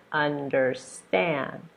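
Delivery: slow un-der-STAND, weight on STAND; final-syllable stress. Shown as a demonstration. Teacher modelling understand, slow, stressed on STAND. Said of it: "Stress is gonna be here".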